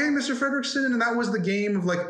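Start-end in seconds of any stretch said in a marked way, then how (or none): 0.0-0.8 put-on much higher, a bright little boy calling out; high-pitched kid voice. Shown as a demonstration. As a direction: squeak it up like an eager kid. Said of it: "trying to get into a high-pitched voice".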